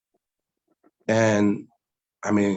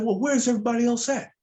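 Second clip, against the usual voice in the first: much higher, bewildered asking; asking the brother where everyone is, high and bewildered; puzzled question; 12 semitones higher, faster than usual. Retold or imitated. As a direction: sound lost and confused. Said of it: "I said".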